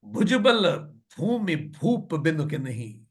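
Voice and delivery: slow, measured verse chanting; verse recitation. A narrator's reading. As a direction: recite the scripture line with drawn-out syllables. (धीमा दोहा पाठ)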